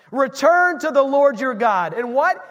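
ordinary talk

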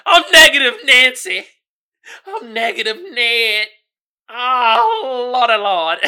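loud comic self-exasperation; hammed-up frustrated holler; exasperated outburst. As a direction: wail it in mock despair, cracking up. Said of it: "you're like".

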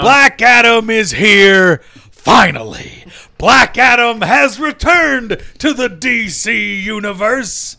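booming The Rock promo; wrestling promo voice. Acted. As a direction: roar it like a wrestling heel.

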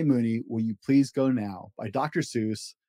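ordinary delivery, nothing marked

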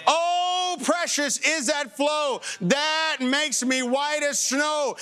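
high, slow, rising preached line; preaching hymn. Real, not acted. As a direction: lift it like a hymn at full force.

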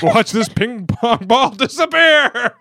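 loud, high, slow magician patter; card magician patter. Pretend. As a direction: dazzle the crowd, slowly. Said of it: "I wanna see that now".

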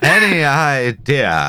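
slow, swooping, hammed-up actor impression; Matt Barry. Joking over a movie line reading, mock thespian voice. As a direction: stretch the words out with plummy theatrical relish. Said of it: "Look at Matt Barry".

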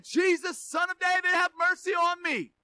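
urgent, high-pitched pleading shout; calling out. Acted. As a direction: call to him across a crowd. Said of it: "Someone called out to him".

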